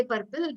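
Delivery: "purple" as PUR-pil, R spoken; R sounded. Retold or imitated. Teacher quotes a common mistake, R sounded. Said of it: "A lot of people say purple".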